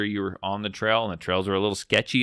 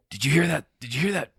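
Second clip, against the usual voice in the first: higher-pitched imitation of a jumpy kid; mock alarm; 6 semitones higher than usual. Son pestering dad on a dark trail, lightly acted alarm. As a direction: lightly mimic a son startled by noises.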